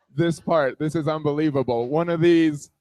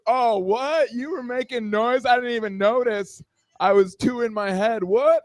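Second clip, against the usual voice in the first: higher-pitched feigned innocence; mock surprise; 5 semitones higher than usual. Man caught echoing moans, feigned surprise. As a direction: act baffled, pitch up.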